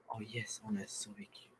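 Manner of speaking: breathy whisper of relief; relieved whisper. Real, not acted. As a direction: whisper it, barely voiced. Relieved after surviving a zombie attack.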